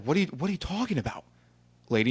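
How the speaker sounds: mock disbelief at the agent, pitch swooping up; incredulous retort. Retelling argument with agent.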